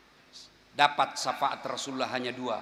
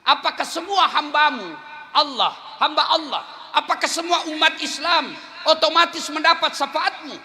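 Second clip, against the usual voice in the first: eager high question; 13 semitones higher, 8 dB louder than usual. Acted. Companion asking eagerly.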